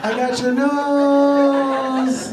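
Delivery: stretched, loud, high-pitched taunt; silly squeal. Retold or imitated. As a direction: squeal it slowly like a kid teasing. Silly nose-grab gag, squeaked.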